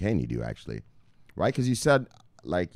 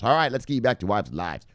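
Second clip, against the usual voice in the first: ordinary delivery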